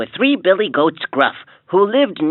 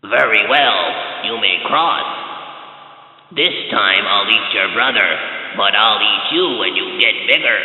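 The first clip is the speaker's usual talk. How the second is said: deep troll voice; 8 semitones lower than usual. A narrator's reading. Troll replies.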